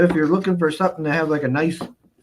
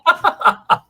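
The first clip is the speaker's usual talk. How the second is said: laughing; 13 semitones higher, 7 dB louder than usual. Real, not acted. Laughing at guest.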